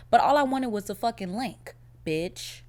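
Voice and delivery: ordinary delivery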